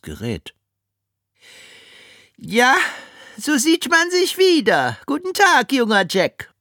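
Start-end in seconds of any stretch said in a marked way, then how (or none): 2.4-6.5 high cooing villainess. Acted. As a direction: coo with false sweetness. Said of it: "gurrte Miss Swine".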